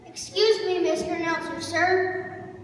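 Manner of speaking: loud, polite interruption; calling out to interrupt. Acted. Young scout to announcer, calling out to interrupt. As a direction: pipe up loudly over the announcer.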